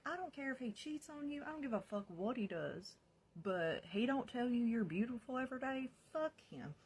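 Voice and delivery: high-pitched defiant outburst; retold heated rant. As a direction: argue it hotly, pitch held high. Retold heated defiant phone reply.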